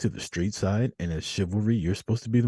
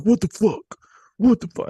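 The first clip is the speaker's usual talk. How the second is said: imitating an angry drunk's shout, higher and louder; angry yell; 12 semitones higher, 7 dB louder than usual. Retelling the drunk guy yelling at the girl, furious shout. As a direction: yell it like a guy losing it over a spilled drink. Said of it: "He's like".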